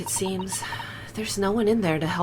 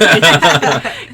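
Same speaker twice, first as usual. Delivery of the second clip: loud laughing; 11 dB louder than usual. Real, not acted. Laughing at farting joke.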